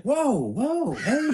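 high, swooping mock objection; imitated protest. Wife stopping dad's yelling, imitated protest. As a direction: imitate a taken-aback spouse calling a halt.

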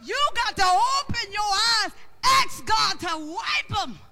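loud, high preacher's shout; big pulpit holler, words stretched out; full-force preaching. Real, not acted.

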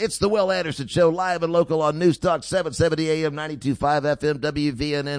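loud, upbeat host plugging his show; radio station ID. Acted. Radio host signing off to a break, loud announcer read.

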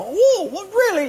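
high incredulous whoa; amazed disbelief. Acted. Friend doubting the Hawaiian guy's claim, high amazed whoa. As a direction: sound wide-eyed with surprise.

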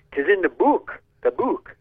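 a mock Scottish preacher accent, rising on the book. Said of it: "listen to a Scottish preacher one time".

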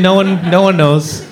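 ordinary delivery

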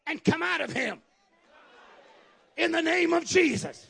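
high, ringing command to a demon; authoritative pulpit order; with authority. Shown as a demonstration. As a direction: command it out firmly. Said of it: "say it with authority".

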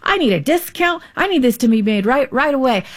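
high-pitched, demanding protest without raising the volume; acted indignant customer insisting on a fix; aggressive complaint. Aggressive diner complaining about a wrong order, indignant. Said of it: "the aggressive communicator is like".

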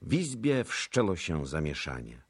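usual speaking voice

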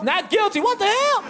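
very high incredulous outcry; hammed-up astonishment; shocked disbelief. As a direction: react aghast, voice shooting way up. Acted verdict and shocked reaction.

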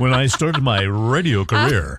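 hammy radio-host impression; mock radio voice. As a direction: tease with an exaggerated radio voice. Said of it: "is that your radio voice".

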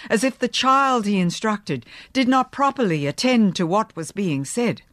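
plain reading voice

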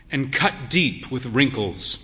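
ordinary delivery, nothing marked

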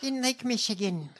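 high-pitched parody politician voice; hammed-up mimicry of a folksy story; mocked candidate's anecdote. Host parodying the candidate's focus-grouped anecdote, exaggerated put-on voice. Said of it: "You sound insane".

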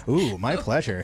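low-pitched playful "ooh"; suggestive purr, acted. Shown as a demonstration. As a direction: say it low, as a teasing come-on. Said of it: "say it like that".

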